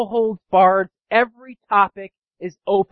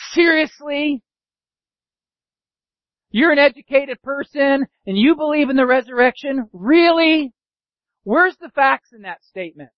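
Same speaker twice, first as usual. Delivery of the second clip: higher, mocking disbelief; sarcastic scoffer; 5 semitones higher than usual. Skeptic mocking a believer in the resurrection, sarcastic. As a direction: point your finger and scoff. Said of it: "sarcastically cutting it down".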